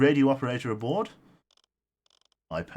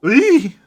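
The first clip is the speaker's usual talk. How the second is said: sudden high, loud squeal of surprise; excited squeal; 13 dB louder than usual. Real, not acted. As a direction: squeal with delight. Shocked by big donation.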